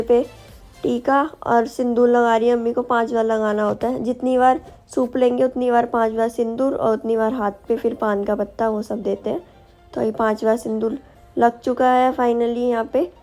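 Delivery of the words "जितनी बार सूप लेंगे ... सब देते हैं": ordinary talk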